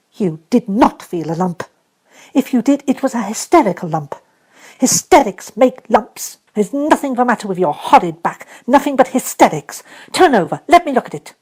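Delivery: put-on high, loud, bossy girl; contemptuous little mistress; stern scolding. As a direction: scold him flat out. Mary scolding the boy.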